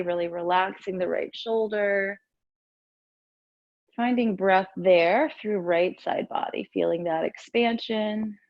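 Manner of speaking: usual speaking voice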